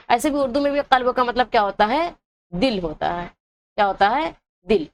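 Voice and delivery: normal talking voice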